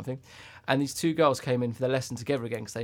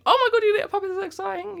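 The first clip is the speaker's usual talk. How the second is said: higher, gushing mock-excited girl voice; imitating excited schoolgirls; 6 dB louder than usual. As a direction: gush like a thrilled twelve-year-old. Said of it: "they were sort of saying".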